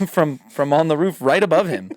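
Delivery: normal talking voice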